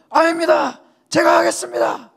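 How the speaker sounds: high, loud recruit shouting back; determined shout. Retold or imitated. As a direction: shout it like you refuse to quit. Recruit refusing to quit. (그만두지 않겠다고 외치는 훈련병)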